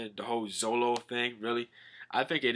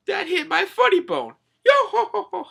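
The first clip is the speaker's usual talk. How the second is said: high character voice breaking into laughter; hammed-up skeleton-style yo-ho-ho laugh; Brook laughing; 9 dB louder than usual. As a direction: cackle it way up high. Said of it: "even Brook's laughing at".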